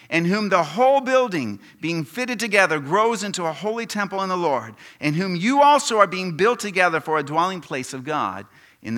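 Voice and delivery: loud, high, ringing preacher's delivery; forceful scripture reading. Acted. Preacher reading Ephesians to the congregation, proclaimed loudly. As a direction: proclaim the verses at full force.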